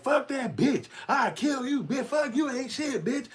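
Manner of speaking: very high, whiny put-on voice; high falsetto mock rage; mock angry girl. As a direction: screech insults in a high mocking voice. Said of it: "it's like".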